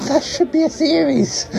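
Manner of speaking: high squeal of amusement; tickled giggling. Pretend. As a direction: laugh into it, pitch way up. Laughs at own pun.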